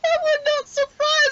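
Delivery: slow, lifted, high voice; high slow character voice. Acted. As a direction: draw it out up high.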